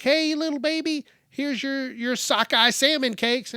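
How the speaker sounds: high-pitched mock baby talk; cooing parent. Parent offering dinner to a toddler, high cooing baby talk. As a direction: coax a baby in a silly high voice.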